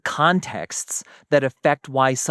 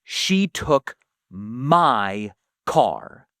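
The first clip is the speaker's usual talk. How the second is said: "my" drawn out long in a very slow sentence; stress on my; 2 semitones lower, much slower than usual. Shown as a demonstration. Example of claiming what is one's own, "my" stressed and stretched. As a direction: lean heavily on "my", stretching it slowly. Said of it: "I'm emphasizing the word mine".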